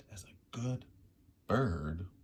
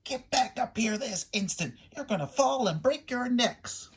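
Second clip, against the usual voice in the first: higher, louder scolding; mother bird yelling at babies; 12 semitones higher, 9 dB louder than usual. A narrator's reading. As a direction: scold the chicks sharply from the nest.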